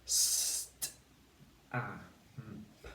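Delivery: "St-, a, m, p" as separate sounds, long s into a crisp t; sounded out. Shown as a demonstration. Teacher inserts the missing sound for pupils, sounded out. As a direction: segment "St-, a, m, p" with gaps between sounds. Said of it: "They need a t sound".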